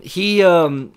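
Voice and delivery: ordinary talk, nothing marked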